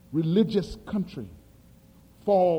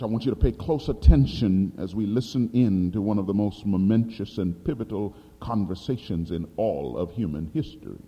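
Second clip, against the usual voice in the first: ordinary talk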